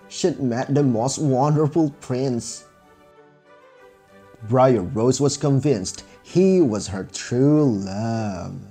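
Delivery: ordinary delivery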